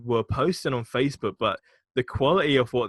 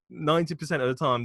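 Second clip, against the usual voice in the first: usual speaking voice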